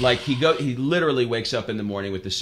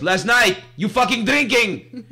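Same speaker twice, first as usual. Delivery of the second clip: put-on high, loud Greek-accented accusation; angry old Greek man; 12 semitones higher, 7 dB louder, slower than usual. Greek owner accuses his customer of drinking, loud Greek accent. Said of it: "He goes".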